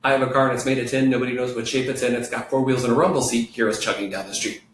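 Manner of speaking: rapid run-through, words tumbling together; very fast. Shown as a demonstration. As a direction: rattle it off in one breath. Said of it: "But not that fast yet".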